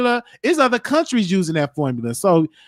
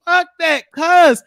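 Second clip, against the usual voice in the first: emphatic shout; 9 semitones higher, 4 dB louder than usual. Real, not acted. Urging candidates to cuss.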